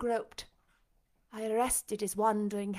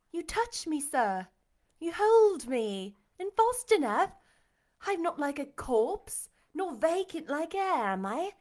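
put-on higher, louder, animated young woman; eager reassurance; 6 semitones higher, 6 dB louder than usual. A narrator's reading. Jane proving to her master she is real, eagerly. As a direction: convince him with lively insistence.

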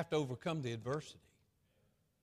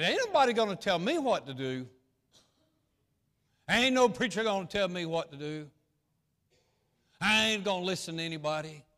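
defiant; 3 semitones higher, 5 dB louder than usual. Retold or imitated. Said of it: "You say".

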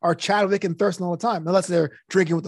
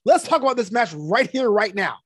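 higher, louder hype announcer; wrestling promo voice; 5 semitones higher, 4 dB louder than usual. Acted. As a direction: sell the match like a wrestling promo. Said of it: "a sports presentation of things".

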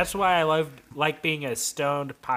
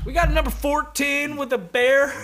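high, sing-song order call; coworker calling orders; 10 semitones higher, 4 dB louder than usual. Retold or imitated. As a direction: call the order out like a sandwich shop worker. Brennan calling orders at work.

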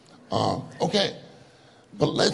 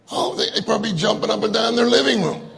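higher, fast and eager; excited; 7 semitones higher, 4 dB louder, faster than usual. Acted. Picturing fans on the telly, fast high excited. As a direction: pick up speed with excitement.

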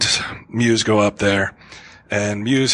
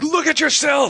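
mock shout. Mock harsh rehab confrontation.

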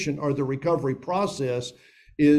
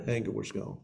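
soft echo; 5 semitones lower, 11 dB quieter than usual. Acted. Preacher echoing his point to the congregation, softly. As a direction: quietly repeat it, much softer.

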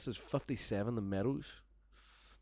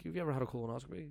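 fast taunt; 6 semitones higher, much faster than usual. Retold or imitated. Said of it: "I was like".

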